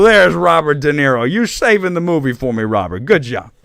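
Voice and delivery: hammed-up Italian accent drifting Southern. Host thanking the actor in bad Southern-Italian accent. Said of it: "it's almost like a Southern".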